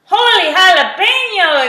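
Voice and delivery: loud, high exclamation; exclaiming. A narrator's reading. Kitty tumbles into stuffed animals, exclaiming. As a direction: burst out in amazement. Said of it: "exclaimed Skippy John Jones".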